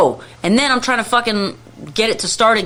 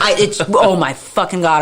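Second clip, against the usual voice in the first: usual speaking voice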